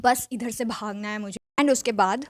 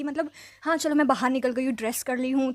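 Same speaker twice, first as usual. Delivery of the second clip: ordinary talk, nothing marked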